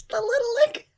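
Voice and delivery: laughing squeal. Real, not acted. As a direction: squeal "The little lick" while laughing. Repeats phrase, laughing squeal.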